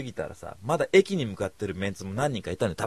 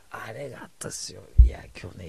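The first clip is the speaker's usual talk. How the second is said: hushed whisper. Real, not acted. To listeners, whispering a secret about the Amway office.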